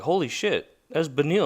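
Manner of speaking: buzzing with retold excitement; retold amazement. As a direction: replay the thrill of recognizing him. Retold shock at meeting fighter's dad.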